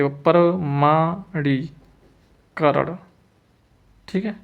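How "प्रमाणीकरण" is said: प्रमाणीकरण slowly as प्र-मा-णी-करण; drawn-out syllables in प्रमाणीकरण; slow dictation. Shown as a demonstration. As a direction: dictate प्रमाणीकरण with gaps between parts. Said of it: "प्रमाणीकरण ऐसे लिखेंगे".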